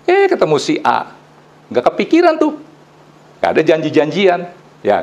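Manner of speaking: ordinary delivery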